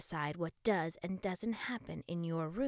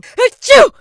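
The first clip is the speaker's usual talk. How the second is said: huge high achoo; explosive sneeze; loud sneeze; 28 dB louder than usual. A narrator's reading. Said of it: "each word was interrupted".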